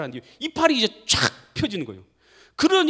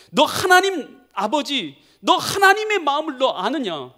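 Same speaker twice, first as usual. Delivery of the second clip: high, loud, emphatic demand; stern challenge; 7 semitones higher, 6 dB louder, slower than usual. Acted. God confronts Jonah about the Father's heart, sternly. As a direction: press the question home forcefully.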